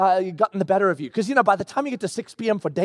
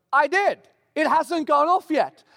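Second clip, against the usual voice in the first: raised pitch, mock defensive; acted defensive protest; 6 semitones higher, slower than usual. Husband answering wife about the alarm, mock defensive.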